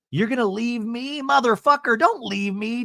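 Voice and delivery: high, whiny plea; mock pleading. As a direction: whine like a clingy friend. Imitating Terry begging Eddie.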